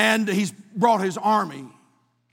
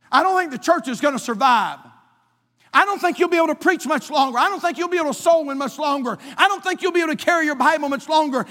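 devil taunting; 9 semitones higher, 5 dB louder than usual. Acted.

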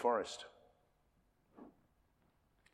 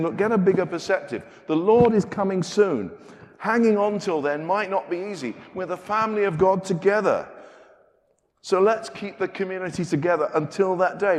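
urgent preaching appeal; 6 semitones higher, 6 dB louder than usual. Acted. Preacher voicing James's urgent appeal.

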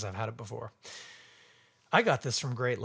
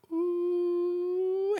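high appreciative ooh. Real, not acted.

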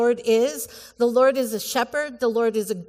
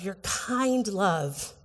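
ordinary talk, nothing marked

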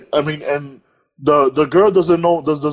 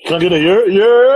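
loud call-and-response yell; hype call; 3 semitones higher, 4 dB louder than usual. Real, not acted. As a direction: shout it back like a hype man.

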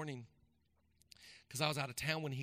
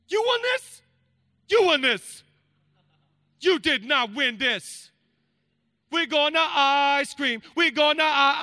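shrieking high with disbelief, then louder; hammed-up amazement at a son's medal; mock-thrilled dad freaking out over a trophy; over-the-top excited dad; 8 semitones higher, 10 dB louder, slower than usual. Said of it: "So I'm like".

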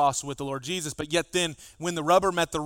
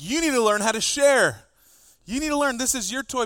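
put-on louder, higher parent telling a child to share; firm call to share the toy; scolding parent; 8 semitones higher, 6 dB louder than usual. Parent scolding child over a toy, louder and higher. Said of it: "you may say something like".

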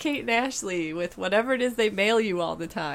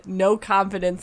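usual speaking voice

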